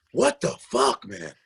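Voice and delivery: usual speaking voice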